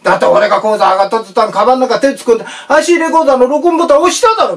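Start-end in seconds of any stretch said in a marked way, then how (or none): 2.7-4.5 mock accusation.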